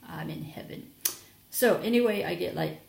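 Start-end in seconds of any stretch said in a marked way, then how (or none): 0.1-0.9 whispered softly, in bliss; blissful whisper. Real, not acted. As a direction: breathe it out, quietly delighted. Blissful over honey gift.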